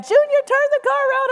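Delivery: high-pitched panicked grandmother yell, imitated. Grandmother in the back seat to Dad, mock yelling. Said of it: "she started yelling at my dad".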